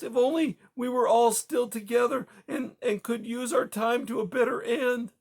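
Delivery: high, loud, tearful despair; despairing sobs. Acted. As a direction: break down, voice high and crying. Widow breaking down in despair.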